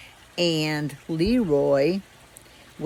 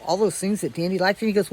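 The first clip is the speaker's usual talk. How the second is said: usual speaking voice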